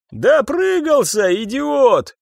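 high, drawn-out heckling; jeering shout. A narrator's reading. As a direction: yell it mockingly from another cell. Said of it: "кричали из камер". (насмешливый выкрик)

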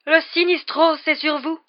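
high, loud proclamation of doom; dread-struck seer. Acted. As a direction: proclaim the omen with theatrical horror. Seer announces the death omen.

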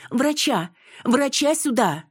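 high-pitched urgent cry; calling for help. Acted. Mother summoning a doctor to the ward, urgent cry. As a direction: call out sharply for a doctor, pitch jumping high.

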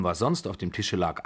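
usual speaking voice

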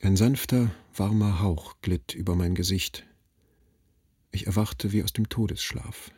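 usual speaking voice

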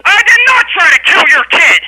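loud, high-pitched angry shout; outraged denial. Real, not acted. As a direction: deny it at the top of your temper. Clerk shouts denial of accusation.

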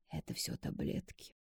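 breathy sighed whisper; sighing whisper. A narrator's reading. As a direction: sigh the words out. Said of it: "снова вздохнул Никита".

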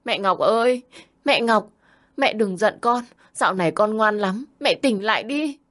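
put-on high, pleading little boy calling out; a small boy begging his sleeping mother to wake; child crying out. A narrator's reading. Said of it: "tiếp tục gào thét". (giọng bé trai gào gọi mẹ, cao và nài nỉ)

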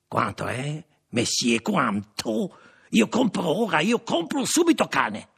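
high, insistent voice of a foreign buyer; eager French buyer. A narrator's reading. Leclerc to the shopkeeper, buying the dog, high and insistent. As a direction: climb from question to high, pressing demand. Frenchman haggles to buy the puppy.

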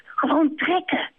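just do it, she urges; urging hen. Acted. Kip urging.

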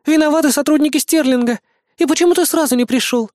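high, agitated woman's voice; upset woman, pitched well up; concerned young woman. A narrator's reading. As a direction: reproach him in a high voice. Woman reproaches the boy.

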